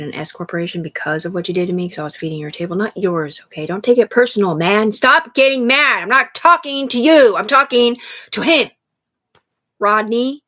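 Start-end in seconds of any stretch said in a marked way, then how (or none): 3.7-10.4 raised voice, slower and higher; loud and higher, dragging the words out; loud telling off. Real, not acted. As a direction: snap back at him to stop getting mad. Telling someone to stop getting mad.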